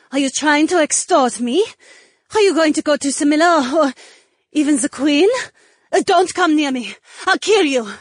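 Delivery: put-on high, loud accented female voice; cornered and threatening, rising to a high shout; panicked guard. A narrator's reading. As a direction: lash out in alarm, high and loud. Cornered guard threatens, secret exposed.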